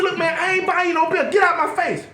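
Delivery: high, indignant brush-off; mock irritation. Old-school man refusing a young guy, mock irritation. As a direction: wave him away indignantly. Acted refusal to pushy kid.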